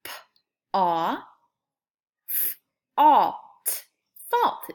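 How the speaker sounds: segment then blend fault; sounding out. Shown as a demonstration. Teacher sounding out fault for kids. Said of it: "both have the same sound".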